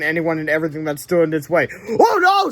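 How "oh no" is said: much higher, loud cry of fright; scared scream. Acted. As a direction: yelp it like a terrified kid. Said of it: "screamed Hal".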